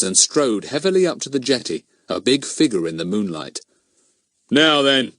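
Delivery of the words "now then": louder, higher policeman's warning; stern opening. Acted. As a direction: start like a bobby taking charge. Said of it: "he began".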